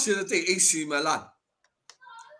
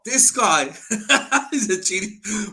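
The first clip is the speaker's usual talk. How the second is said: laughing; 3 semitones higher, 4 dB louder, slower than usual. Real, not acted. Laughing at rival's gloating.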